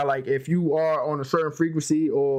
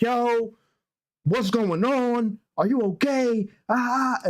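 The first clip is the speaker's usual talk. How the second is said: imitated freak-out; 6 semitones higher, slower than usual. Aunts checking on a frightened child, imitated freak-out. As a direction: mimic a panicked aunt, pitch raised. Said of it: "my aunts was freaked out".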